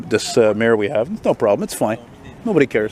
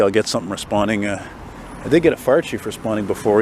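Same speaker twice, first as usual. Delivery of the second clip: ordinary talk, nothing marked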